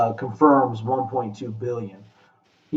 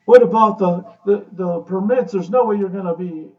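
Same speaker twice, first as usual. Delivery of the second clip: higher, louder nagging doubts; mock worried objection; 7 semitones higher, 11 dB louder than usual. Quoting Jess's loud, high worried objections about permits. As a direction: voice the skeptic raising alarms. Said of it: "immediately Jess goes".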